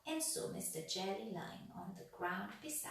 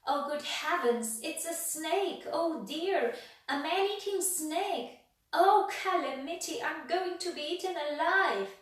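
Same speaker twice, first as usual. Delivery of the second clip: high, loud hammed-up panic; panicky fussing; 8 semitones higher, 10 dB louder than usual. A narrator's reading. Mister Jelly mistakes a worm for a snake, high panic. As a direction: wail it like a fusspot in terror.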